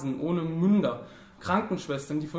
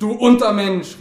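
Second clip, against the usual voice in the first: very loud, raised angry cry; shouted insult; 6 semitones higher, 13 dB louder than usual. A narrator's reading. Two wounded enemies trade abuse, shouting angrily. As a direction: yell the abuse at him. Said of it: "rief der eine".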